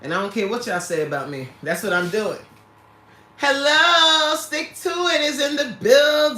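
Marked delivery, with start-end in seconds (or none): 3.4-6.4 high, loud, sung-out greeting; big stretched-out hello; hyped announcement. Real, not acted. Announces a viewer arriving.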